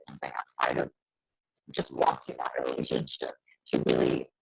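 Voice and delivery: distorted robotic glitchy voice; garbled like a bad connection. Real, not acted. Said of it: "You're sounding like a robot right now".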